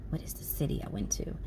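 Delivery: soft unvoiced whisper, thinking aloud; whispering to herself while searching her memory. Real, not acted. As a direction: murmur the question to yourself while trying to remember. Trying to recall city name.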